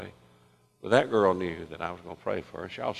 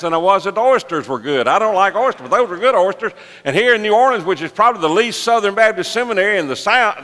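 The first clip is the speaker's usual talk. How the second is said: high, loud, full-force sermon storytelling; excited preaching; 10 semitones higher, 10 dB louder than usual. Acted. Preacher telling church about the surprise at Drago's, excited preaching. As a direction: belt out the testimony with rising excitement.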